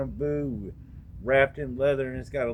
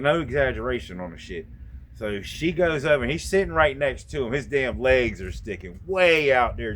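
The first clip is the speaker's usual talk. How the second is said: ordinary talk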